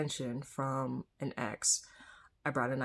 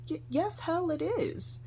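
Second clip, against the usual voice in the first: indignant retort; 14 semitones higher, slower than usual. Retold or imitated. Acted retort to dismissive people.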